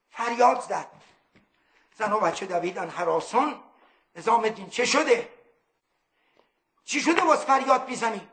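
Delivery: normal talking voice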